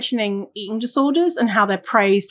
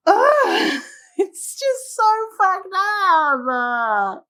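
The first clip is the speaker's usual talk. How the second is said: high, drawn-out wail of dismay; long despairing groan into words; exasperated whine; 10 semitones higher, much slower than usual. Real, not acted. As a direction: whine it slowly, nearly crying. Exasperated at HR practice.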